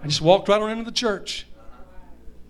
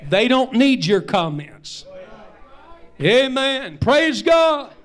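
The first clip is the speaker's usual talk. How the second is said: drawn out and forceful, each word weighed; preacher slowing down to drive the rebuke home; slow preaching emphasis; 3 semitones higher, slower than usual. Real, not acted. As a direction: lean hard and slow on the rebuke.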